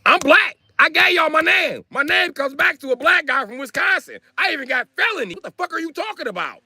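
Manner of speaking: high-pitched, offended rant; indignant protest. Retold or imitated. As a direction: plead your case in outraged disbelief. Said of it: "So I get mad".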